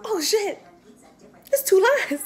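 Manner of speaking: the moment you see the test; shocked. Retold or imitated. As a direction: disbelief at the pregnancy test. Seeing the positive test.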